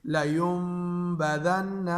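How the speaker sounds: لَيُنبَذَنَّ slow, nasal ghunna held on the نّ; recited with ghunna. Shown as a demonstration. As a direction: chant لَيُنبَذَنَّ slowly, humming through the nose on the نّ. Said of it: "غنہ کے ساتھ پڑھیں گے".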